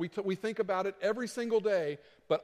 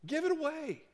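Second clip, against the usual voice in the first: urging plea; 11 semitones higher than usual. Real, not acted. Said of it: "urging congregation".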